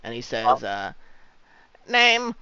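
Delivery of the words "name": high clipped demand; curt dwarf clerk. Acted. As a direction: bark out the question. GM voices scribbling dwarf.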